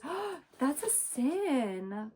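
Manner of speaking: high, pearl-clutching mock voice; mock her as shocked and prim; mock scandalized. Mocking the sister's horror, high and scandalized. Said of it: "your thought is".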